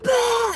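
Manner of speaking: sudden loud, high yelp; imitated pain cry. Arm twisted behind her back, loud high yelp. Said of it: "he'd twist and I'd be like".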